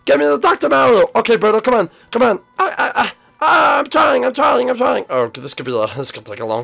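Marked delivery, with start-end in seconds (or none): 0.1-5.1 high, loud, frantic repetitions; hammed-up labour panic; play-acted panic. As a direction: fret loudly in mock urgency.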